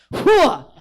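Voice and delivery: high, loud startled shout; breaking up a fight. Retold or imitated. Splitting up two fighting girls, loud whoa. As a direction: yell it to stop the fight. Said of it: "I'm just like".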